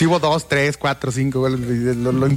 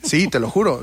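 ordinary delivery

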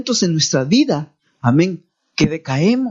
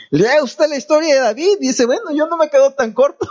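high-pitched, laughing as he talks; amused; 6 semitones higher than usual. Real, not acted. Pastor jokes with congregation about David's story, amused. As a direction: joke with the listeners, breaking into chuckles.

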